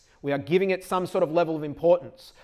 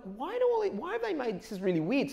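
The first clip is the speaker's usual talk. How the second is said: puzzled; 9 semitones higher than usual. Retold or imitated. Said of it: "I'm like".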